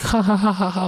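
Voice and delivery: high-pitched imitated laughter; mock giggling laugh. Imitating a girl laughing at his jokes, mock giggle. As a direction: put on a girl's high "ha ha" laugh. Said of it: "laughing, right?".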